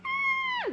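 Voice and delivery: very high squeal; excited squeal. Retold or imitated. Screaming back at her friend, high squeal.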